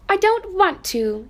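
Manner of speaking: a high, stubborn little-rabbit refusal; little rabbit refusing. Acted. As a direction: refuse so nobody can see. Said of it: "he said again".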